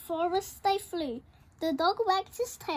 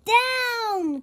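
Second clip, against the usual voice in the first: shouted; 7 semitones higher, 9 dB louder than usual. Acted. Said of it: "cried the witch".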